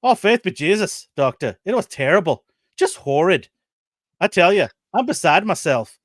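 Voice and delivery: a hint of an Irish woman lamenting; Irish old woman. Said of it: "Let me get my Irish accent out".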